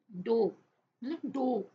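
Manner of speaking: "डो" said loudly with a clear o; o-sound demo. Shown as a demonstration. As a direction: model the o sound in "डो", emphatically. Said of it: "ओ की sound आ रही है". ("डो" में ओ की आवाज़ पर ज़ोर)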